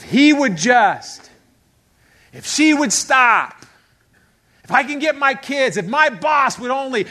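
high, worked-up complaining; exasperated. Acted. People trying to change spouse, kids and boss, exasperated. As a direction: pile up grievances in exasperation.

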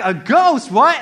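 loud, high startled questions; acted alarm and astonishment; shocked disbelief. Disciples hearing there's a ghost, shocked disbelief.

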